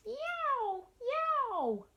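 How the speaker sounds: very high yowling cat; tomcat yowl. Acted. Teacher yowling like the tiger tomcat. Tomcat sound read aloud.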